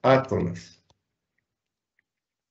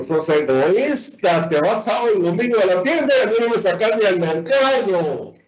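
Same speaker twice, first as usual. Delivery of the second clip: higher-pitched mock nagging wife, speeding up; complaining wife; 5 semitones higher than usual. Wife scolding husband over weekends at the shop, mock complaint. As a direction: play a wife reeling off her complaint. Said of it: "Así le dice la mujer a uno".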